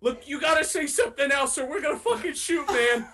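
high, loud, urgent threat; shouted warning. Acted. Party member threatens to shoot the waving stranger, shouted warning. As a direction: yell a warning to someone far off.

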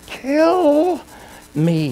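high, drawn-out mock cry that drops away; retold scream. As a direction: imitate a strained scream without volume. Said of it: "He was screaming".

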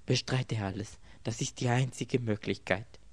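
ordinary delivery

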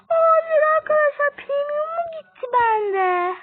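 high, loud dismayed outburst; dismayed cry. Real, not acted. Gamer to viewers on losing premium, dismayed cry. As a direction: wail in disbelief at the loss.

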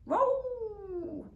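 high, loud drawn-out yowl; a stuck cat yowling. A narrator's reading. Stuck cat yowls down from the tree, high and loud. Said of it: "the sound that echoed around".

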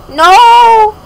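loud high no; shouted no. Real, not acted. Upset she still can't move, loud high no. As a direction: cry it out in dismay.

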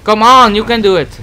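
loud high cheering on; root for it loudly; excited urging. Real, not acted. Urging himself during the fight.

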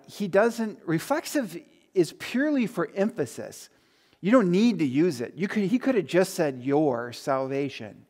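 usual speaking voice

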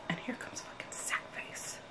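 breathless, hushed whisper. Real, not acted. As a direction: whisper so the killer won't hear. Watching killer approach on screen.

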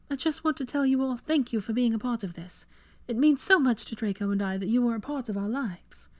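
a grateful hostess thanking her guests; Hermione's toast. Acted.

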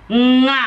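Nga said through the nose, tongue still; nasal ng demo. Shown as a demonstration. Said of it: "You use your nose inside".

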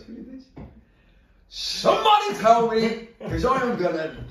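high, slow, loud mock rage; imitating Andy. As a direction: drag it out like a sitcom tantrum. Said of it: "and he goes".

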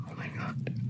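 barely voiced oh my God; stunned whisper. Real, not acted. Reader stunned by the betrayal, whispering. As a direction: breathe it out in shock. Shocked by a plot twist.

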